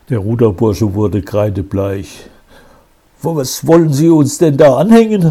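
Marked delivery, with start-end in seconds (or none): 3.2-5.2 high, loud and fast in shock; horrified cry. A narrator's reading. Accused boy cries out at the commissioner, horrified. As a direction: blurt it out, horrified. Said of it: "rief er entsetzt".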